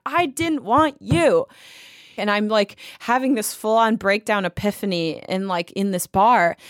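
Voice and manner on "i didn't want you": high, drawn-out mock near-tears wail; slow, plaintive re-enacted protest; almost crying. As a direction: act out being on the verge of tears. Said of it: "almost crying and being like".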